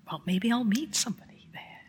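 breathy hushed whisper of a hopeful secret; whispered aside. Retold or imitated. Whispering to her friend Liz, hoping to meet someone.

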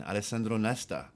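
ordinary talk, nothing marked

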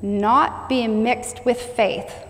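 every word drawn out, stressing not; preacher stressing not, very slow; slow emphatic reading. Shown as a demonstration. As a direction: linger on each word.

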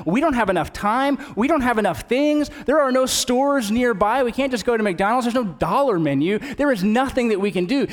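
high, insistent list of objections; mock-frazzled disciple, pitched high; exasperated objection. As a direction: pile up reasons it can't work. Disciples object the task is impossible.

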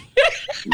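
high cackling laugh; bursting out laughing. Real, not acted. Loud laugh at the question.

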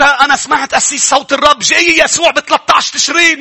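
hammed-up believer, high and loud; mock the false prophecy, crying it out. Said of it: "لأنه أنت". (تقليد ساخر لمؤمن يصرخ)

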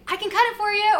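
high, loud and helpful; mock eager offer. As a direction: imitate an eager stranger calling out. Retelling runner's eager offer.

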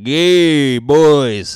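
loud high joking yell; mock yell. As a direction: shout it out to the boys in jest. Mock shout to listeners.

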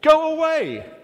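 loud, high annoyed shout from the window; yelling neighbour. Retold or imitated. Neighbour yelling at the late-night knocker, loud and high. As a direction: bellow it like a neighbour woken in the night. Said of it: "he yells out and he says".

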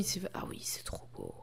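breathless hushed whisper at a beautiful cover; awed whisper. Real, not acted. Admiring the cover art to her co-host, whispered in awe. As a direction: hush it out in wonder, no voice.

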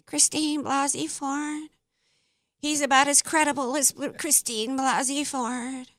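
high, soft, drawn-out mock voice; mock them in a small high voice; slow, quiet mimicry with the pitch held up; mocking put-on voice. Mocking the accuser by name.

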